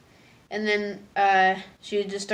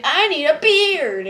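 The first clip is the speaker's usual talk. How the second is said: higher, louder, slow eager whine; eager plea; 8 semitones higher, 8 dB louder, slower than usual. Retold or imitated. Marco wanting a beard, eager whine. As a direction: beg for a beard like a kid.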